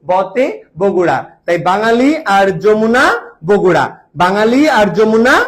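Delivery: বতে Bogura said slowly, each word spaced for the class to repeat; slow it down so they can chant বতে Bogura back; slowed memorising chant of বতে Bogura; slow drill. Shown as a demonstration. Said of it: "এই মুখস্থ করো আমার সাথে".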